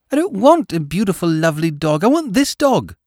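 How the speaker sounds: put-on a boy stamping his foot; defiant small boy. A narrator's reading. Boy refuses the nurse.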